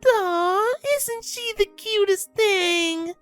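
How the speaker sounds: very high, slow coo; drawn-out, high-pitched gushing; gleeful cooing. A narrator's reading. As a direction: coo over a baby in delight. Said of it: "Surprise cooed gleefully".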